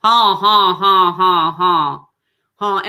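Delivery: loud constricted honks, tonsils touching; honk drill. Shown as a demonstration. Coach demonstrating honks with tonsils touching, loud. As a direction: honk with a tight throat. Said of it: "your tonsils are touching".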